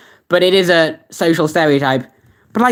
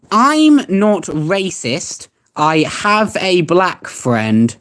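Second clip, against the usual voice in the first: very slow, word by word; slow, deliberate dictation; much slower than usual. Real, not acted. Streamer reading a search aloud as he types, slow and deliberate. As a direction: spell it out slowly, as if typing it.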